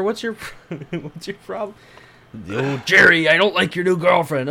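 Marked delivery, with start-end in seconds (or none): 2.5-4.5 Kramer voice. Pretend. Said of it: "come on, Kramer".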